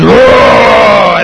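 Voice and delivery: long, loud pretend-bear roar; grizzly bear roar. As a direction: roar like a dad playing grizzly. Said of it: "on his two legs and goes".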